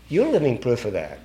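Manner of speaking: soft, falling "You're living proof"; quiet affirmation. Real, not acted. Speaker turning the testimony to the listeners, quiet affirmation. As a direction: hand "You're living proof" over softly. Same words turned gently on listeners.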